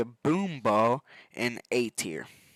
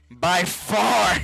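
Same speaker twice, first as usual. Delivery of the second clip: shouted; 3 semitones higher, 5 dB louder than usual. Real, not acted. Emphatic shout at camera.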